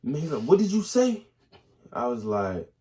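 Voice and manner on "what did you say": high, sharp mock snap; sergeant snapping. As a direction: snap it at the recruit. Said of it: "drill sergeant snapped at me".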